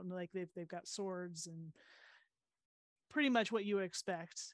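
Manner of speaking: normal talking voice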